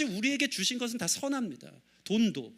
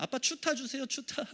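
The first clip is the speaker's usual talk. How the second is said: put-on higher, softer begging kid; wheedling child; 5 semitones higher, 5 dB quieter than usual. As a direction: imitate kids pestering dad. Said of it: "계속 때를 씁니다". (조르는 아이 흉내)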